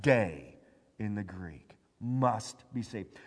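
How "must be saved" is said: usual speaking voice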